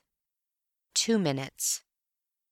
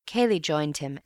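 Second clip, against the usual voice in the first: ordinary delivery, nothing marked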